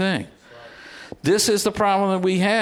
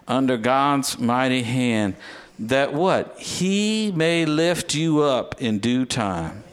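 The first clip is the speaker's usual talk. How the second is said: low, slow scripture read; 4 semitones lower, slower than usual. Real, not acted. Preacher closing the sermon with scripture, slow low reading. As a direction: read the verse slowly and low, like a benediction.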